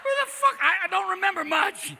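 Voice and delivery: very high, loud befuddled voice; hammed-up high stoner confusion; stoned confusion. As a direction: squeal in comic bewilderment. Acts out being stoned and confused.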